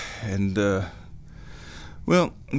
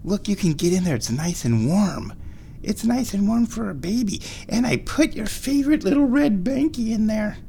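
high, sing-song pet voice; 10 semitones higher than usual. Real, not acted. Baby talk to the cat.

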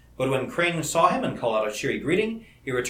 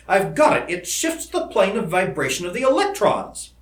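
raised, emphatic eureka delivery; excited breakthrough; 6 semitones higher, 4 dB louder than usual. Acted. Seton explaining his missing factor to his colleague, excited. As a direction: announce the discovery with rising excitement.

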